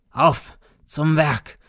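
a harsh, rattling voice rallying to the work; hoarse rasping command. A narrator's reading. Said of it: "mit heiserer, schnarrender Stimme".

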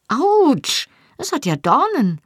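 high-pitched yelp of surprise; startled pain. A narrator's reading. She drops the thorny bundle, startled and hurt. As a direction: cry out as if suddenly pricked, voice leaping up.

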